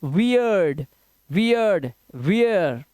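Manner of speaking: "weird" drawn out as WEE-urd; vowels sounded separately. Shown as a demonstration. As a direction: let both vowels of "weird" be heard. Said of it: "स्वतंत्र रूप से हो रहा है".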